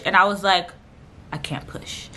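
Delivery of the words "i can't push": very soft, whispered retold protest; hushed dread. Patient answering doctor about pushing, hushed refusal. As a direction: barely voice it, almost no sound.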